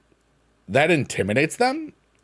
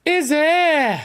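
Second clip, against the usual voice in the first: high squeaky put-on voice, louder; silly squeak; 4 dB louder than usual. Streamer reacting to chat in a high cartoon squeak.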